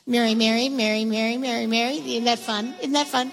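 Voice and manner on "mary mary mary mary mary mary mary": Mary, Mary tumbling out as a quick tongue twister; rattled off fast. Shown as a demonstration. Teacher showing the kids the fun of saying it fast. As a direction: race through each Mary, bouncy and playful. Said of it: "Say that fast".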